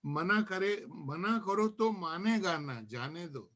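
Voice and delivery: measured verse, lines set apart by pauses; poem recitation. Acted. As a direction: let each refrain fall away at the end. Recites own nazm to gathering. (नज़्म का ठहर-ठहर कर पाठ)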